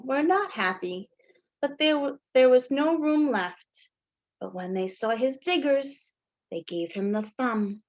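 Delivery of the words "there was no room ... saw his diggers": usual speaking voice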